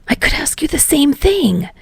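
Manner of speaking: hissed whisper; whispered retort at night. Acted. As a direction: hiss it back in a whisper. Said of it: "he hissed".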